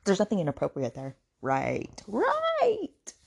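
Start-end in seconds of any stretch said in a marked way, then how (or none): 1.4-2.0 low, short "right"; dry doubtful "right". Real, not acted. Commenting on the just-friends claim, dryly. Sarcastic agreement, first take. 2.1-3.1 drawn-out, sky-high "right"; exaggerated sarcastic "right". Real, not acted. Mocking the just-best-friends claim, very sarcastic. Sarcastic agreement, second take.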